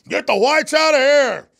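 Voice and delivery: high, loud hammed-up yell, drawn out; mock shout. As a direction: bellow it like a bossy professor. Repeats professor's yell.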